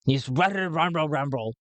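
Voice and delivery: rambling pirate voice; Madman Gotul. Shown as a demonstration. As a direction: ramble through it in character. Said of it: "he talks like this".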